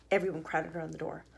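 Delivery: ordinary delivery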